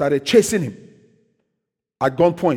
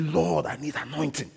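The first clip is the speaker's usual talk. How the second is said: soft, retold plea to God; hushed prayer; 2 semitones lower, 10 dB quieter than usual. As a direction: imitate praying quietly under the breath.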